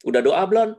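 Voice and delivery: high, loud questioning parent; parent asking. Retold or imitated. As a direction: ask like a worried parent. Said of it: "Misalnya nggak keterima". (orang tua bertanya, tinggi)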